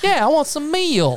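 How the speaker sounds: put-on squeaky little Southern boy; Southern toddler.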